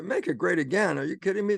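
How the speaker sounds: retold scoffing challenge; incredulous retort. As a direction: throw the idea back in disbelief. Incredulous retort to quoted vision.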